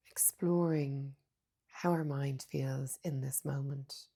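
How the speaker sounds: soft, unhurried meditation voice with long pauses; hushed, calm mindfulness guidance; guided meditation. Acted. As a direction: guide them gently inward, leaving space between phrases.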